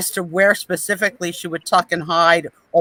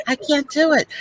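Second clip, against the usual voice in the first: higher, whiny repeat; higher exasperated; 6 semitones higher than usual. Retold or imitated. Angela impression, repeating it higher and exasperated. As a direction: lift it up in exasperation. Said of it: "so here's my Angela".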